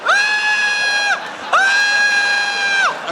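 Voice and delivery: put-on very high, loud girly shriek; shrieking scream. Drunk friends shriek on seeing each other, high squeal.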